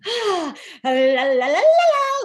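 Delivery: high, loud gleeful babble of la-las; happy la-la-ing. Retold or imitated. Podcaster acting out having the house to themself, gleeful la-la-ing. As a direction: let out a sigh, then burst into high la-la-las. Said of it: "like, happy, where I'm like".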